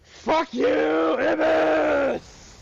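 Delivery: loud taunting yell; gloating shout. Real, not acted. Streamer taunting the beaten boss, loud gloating shout. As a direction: scream it at the boss you just beat.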